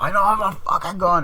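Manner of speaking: loud, high-pitched mock shout; exasperated denial. Accused girl asked about a gun, high exasperated shout. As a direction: shout the denial as if pushed too far.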